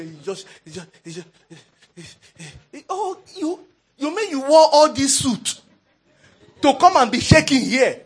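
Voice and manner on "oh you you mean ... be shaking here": embassy officer's scornful challenge; incredulous scolding. Acted. Embassy officer to a trembling visa applicant, incredulous scolding.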